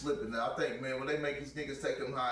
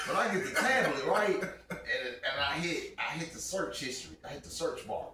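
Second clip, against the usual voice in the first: ordinary delivery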